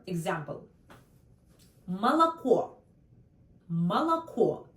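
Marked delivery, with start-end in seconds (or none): 1.9-4.6 Молоко with the first two O said as A and the last O stressed, malaKO; Молоко as malaKO, stress on the final O; Russian молоко. Shown as a demonstration. Teacher models the vowels for learners. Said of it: "three O in this word".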